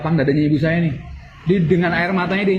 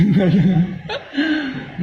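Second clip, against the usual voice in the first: a drawn-out laugh that fades away; rueful little laugh; amused laugh; 4 semitones higher than usual. Real, not acted. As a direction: laugh at himself. Laughs at his own remark.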